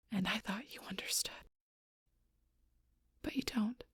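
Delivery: hushed, soft, wounded reproach; quiet hurt. Acted. Confiding betrayal to someone who doesn't understand, hushed and hurt. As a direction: drop to a near-whisper, with halting pauses. Hurt confession after the quarrel.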